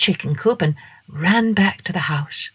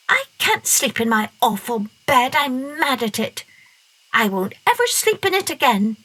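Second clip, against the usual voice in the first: put-on higher, sulky child's voice; sulky girl; 6 semitones higher than usual. A narrator's reading. Masha complaining to the dog about her bed, sulky. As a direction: complain like a cross little girl.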